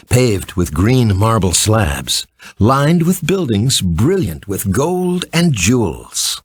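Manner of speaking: plain reading voice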